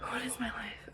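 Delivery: soft voiceless aside; whisper. Real, not acted. Covered in dog hair, whispering to herself. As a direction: mutter it under your breath.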